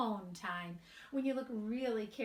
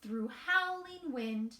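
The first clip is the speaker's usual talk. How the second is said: slow, sing-song storybook reading; gentle storybook narration with light character voices; reading to children; 2 semitones higher than usual. A narrator's reading. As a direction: read it aloud to a group of little kids. Reads picture book to children.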